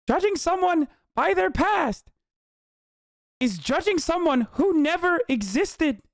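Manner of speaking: high, slow put-on character voice; Lang Buddha. Streamer reading a quote in his voice, Lang Buddha impression. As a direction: attempt an impression far above your own pitch, measured and slow. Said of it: "I'm gonna do his voice".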